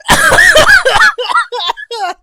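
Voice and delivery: high-pitched helpless laughing fit; real, uncontrolled high laughter. Real, not acted. Streamer cracking up at the singing, helpless laugh.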